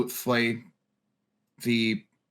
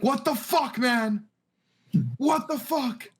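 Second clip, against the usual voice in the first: much higher, startled what the fuck; startled strangers; 12 semitones higher, slower than usual. Acted. Startled people in bed yell at intruders, high and shocked. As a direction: squeak it out like caught in bed. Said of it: "being like".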